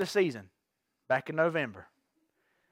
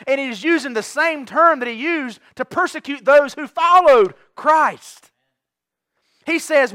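rising preaching; 5 semitones higher, 6 dB louder than usual. Real, not acted. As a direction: build it up and drive it home. Preaching Paul's zeal loudly.

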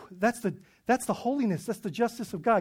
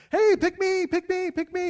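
eager volunteer, high-pitched and loud; excited mock pleading, pitched way up; emphatic, excited; 11 semitones higher, 5 dB louder than usual. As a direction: beg to be picked like a keen kid. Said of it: "pretty emphatic and pretty excited".

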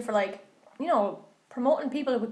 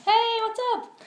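high-pitched greeting; 13 semitones higher, slower than usual. Acted. Playful high greeting on intro.

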